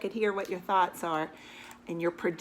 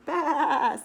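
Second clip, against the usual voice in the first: high, loud bah with a laugh in it; laughing sheep bleat; 6 semitones higher, 8 dB louder than usual. Acted. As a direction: bleat it through a giggle.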